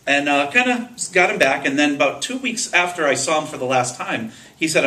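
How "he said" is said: normal talking voice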